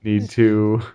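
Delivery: trailing off. Real, not acted. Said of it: "You just trailed off and just gave up".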